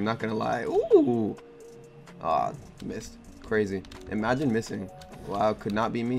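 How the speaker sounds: normal talking voice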